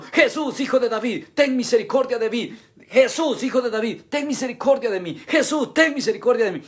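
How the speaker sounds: insistent crying out. Acted. Blind man refusing to be silenced calls to Jesus, crying out. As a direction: keep calling over those hushing you, urgently. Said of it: "él sigue gritando".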